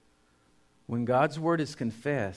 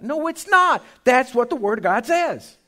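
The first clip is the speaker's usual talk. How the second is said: high, loud preacher's cry; full-force preaching; 11 semitones higher, 11 dB louder than usual. Acted. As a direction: thunder it from the pulpit.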